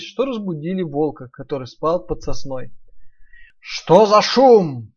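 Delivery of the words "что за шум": slow loud wolf grumble; grumbling wolf. Acted. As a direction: growl it out slowly, hungry. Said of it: "проворчал злой и голодный волк".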